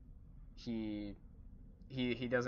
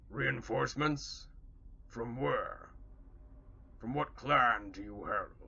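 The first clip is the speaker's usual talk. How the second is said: orc spokesman. Acted. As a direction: question the newcomer as a wary orc. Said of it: "one of them kinda speaks up for the others".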